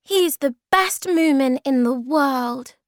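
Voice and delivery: high, loud, indignant defence; angry. A narrator's reading. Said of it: "said Snork Maiden angrily".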